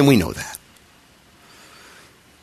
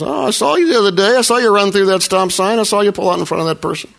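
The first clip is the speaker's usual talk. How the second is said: higher, faster busybody voice; mock tattling neighbor; 7 semitones higher than usual. Imagined onlooker scolding.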